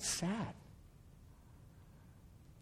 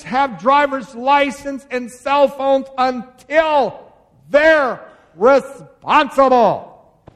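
loud, high, driving sermon delivery; full-force preaching; 8 semitones higher, 9 dB louder than usual. Acted. Pastor preaching to parents at full volume, loud and high. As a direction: hammer each point home to the congregation.

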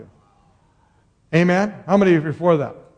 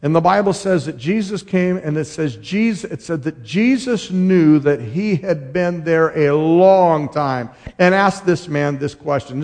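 normal talking voice

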